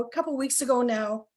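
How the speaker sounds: ordinary talk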